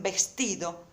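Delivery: VESTIDO stressed on the middle syllable, ves-TI-do; natural stress on the TI of VESTIDO; correct penultimate stress. Shown as a demonstration. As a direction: lean on TI in VESTIDO. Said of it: "esta es la sílaba tónica".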